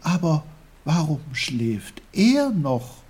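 put-on eager, puzzled boy; puzzled boy Peter. A narrator's reading. Peter asks about the sleeping bear, puzzled.

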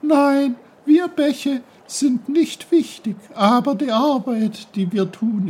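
voiced babbling brook; bubbly brook voice. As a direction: lecture Peter like a lively talking stream. Brook speaks to Peter.

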